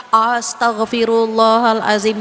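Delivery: loud, drawn-out astagfirullah dripping with sarcasm; sarcastic astagfirullah. Retold or imitated. Friend mocks us for gossiping, loud sarcastic jab. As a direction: needle your gossiping friend with it. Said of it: "nyindir kita".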